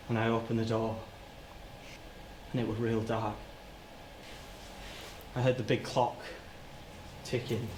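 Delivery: low, soft, halting memory; reliving it in small fragments, with long pauses; quietly dreading each detail; hushed, haunted recollection. Acted. Haltingly recalls finding the victim.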